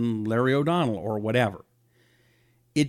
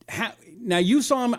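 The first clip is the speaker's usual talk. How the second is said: ordinary delivery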